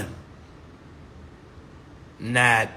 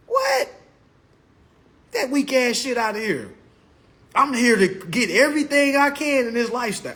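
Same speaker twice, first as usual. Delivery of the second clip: ordinary delivery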